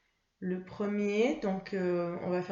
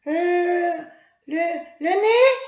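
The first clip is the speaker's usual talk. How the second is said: Mimi; 9 semitones higher, 11 dB louder than usual. Acted. Mimi the puppet answering her teacher, high child voice.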